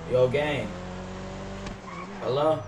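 ordinary talk, nothing marked

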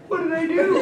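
high-pitched imitation of a woman caught mid-stride; mock-bewildered squeak; startled innocence. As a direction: ask it high, all puzzled. Actress caught walking past.

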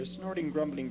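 usual speaking voice

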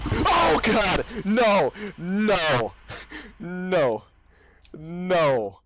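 loud, frantic screaming; real fright yells; startled screams. Real, not acted. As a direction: scream at the jump scare. Jump scare screaming.

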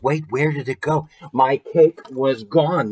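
ordinary delivery

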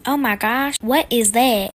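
shocked. Acted. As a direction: react loudly to something bizarre. Mom shocked by mascot.

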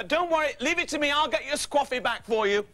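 high, hurried-to-help reassurance; eager reassurance. Acted. Apologetic friend to upset Sue, eager reassurance. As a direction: promise help in a high, placating voice.

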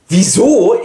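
outraged master locked out; indignant. Acted. Amphitryon outraged at door. (empörtes, lauteres Wieso)

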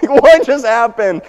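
high, loud, slow disbelief; stunned shout. Retold or imitated. Dad baffled by sudden dinner chaos, loud stunned cry. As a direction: shout it like the room just exploded.

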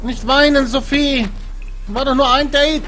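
nervously begging a crying ghost; scared horror game streamer. Real, not acted. (ängstlich flehend)

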